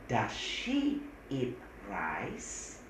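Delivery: very slow, careful English; slow model sentence. Shown as a demonstration. Teacher slowly models the question. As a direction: spell out the example slowly for students.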